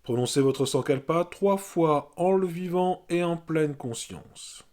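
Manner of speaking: measured meditation voice, spaced by pauses; guided yoga nidra. Real, not acted. Yoga teacher naming body parts for resting listeners, guided meditation voice. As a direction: guide awareness through the body, one evenly placed phrase at a time. Leads body rotation of consciousness.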